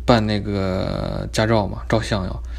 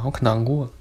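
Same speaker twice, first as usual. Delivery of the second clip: ordinary delivery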